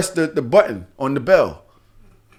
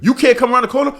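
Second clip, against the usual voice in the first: incredulous challenge; 8 semitones higher, faster than usual. Retold or imitated. Retells challenging the driver.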